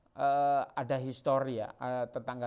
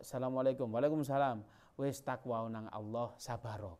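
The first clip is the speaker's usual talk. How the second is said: gentle calm counsel; 3 semitones lower, 5 dB quieter than usual. Acted. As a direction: speak softly like a patient teacher comforting. Rasulullah's words retold in Javanese.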